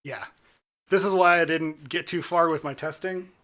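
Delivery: ordinary delivery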